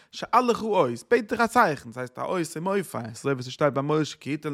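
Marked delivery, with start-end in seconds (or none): 0.1-1.9 rising, much higher and louder proclamation; prophet's cry. Retold or imitated. Prophet urging King Ahaz to ask for a sign, rising loud cry. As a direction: call it out like a prophet demanding a sign. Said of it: "Der hat gesagt".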